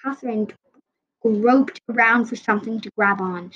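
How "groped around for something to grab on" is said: plain reading voice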